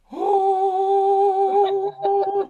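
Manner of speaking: high sung angelic "Oh" from the fridge; heavenly choir "Oh". Pretend. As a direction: sing it like a holy choir chord. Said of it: "the refrigerator going".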